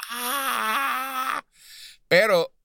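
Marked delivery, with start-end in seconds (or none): 0.1-1.4 sudden high mock scream; mock scream of the frightened kid; imitated scream. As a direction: let out a short high yelp. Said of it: "como que".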